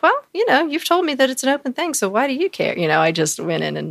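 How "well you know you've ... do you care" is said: high, fast, snippy comeback; sarcastic retort. Retold or imitated. She fires back at her angry boyfriend, sarcastic. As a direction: throw it back at him mockingly.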